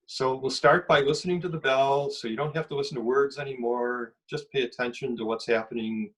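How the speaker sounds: guided meditation. Acted. Guiding prisoners into a closing meditation, calm and soft. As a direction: ease listeners into the bell gently.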